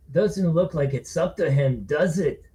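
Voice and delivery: menacing slow taunt; drunk pal. Acted. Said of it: "his pal replied".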